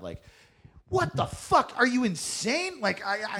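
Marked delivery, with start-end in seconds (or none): 0.9-2.8 high, louder incredulous yelling; mock yell. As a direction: yell it at him in disbelief. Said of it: "yelling at me on stage".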